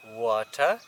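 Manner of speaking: normal talking voice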